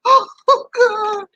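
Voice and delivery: very high mock sob; mock wailing. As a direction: wail it in pretend drunken dismay. Acts out seeing her mama arrive.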